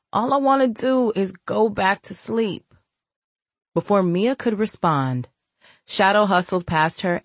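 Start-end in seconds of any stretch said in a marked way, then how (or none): 0.1-2.7 muffled voice. Acted. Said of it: "her muffled voice called out from the pillow".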